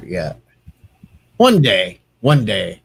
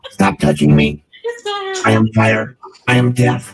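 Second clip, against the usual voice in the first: grim reaper voice. Shown as a demonstration. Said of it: "I think I can come up, uh, with a voice for that one".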